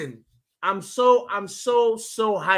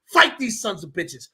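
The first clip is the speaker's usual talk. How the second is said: normal talking voice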